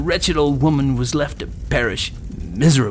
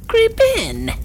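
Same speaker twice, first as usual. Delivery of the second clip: very high, louder crone command; witch; 6 dB louder than usual. A narrator's reading. Witch orders Gretel into the oven, crone voice. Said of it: "said the witch".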